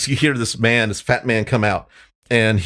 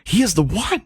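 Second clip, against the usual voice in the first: high-pitched acted disbelief, rising at the end; astonished crowd member; 11 semitones higher than usual. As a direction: play an onlooker in high-voiced awe. Astonished crowd after the hit.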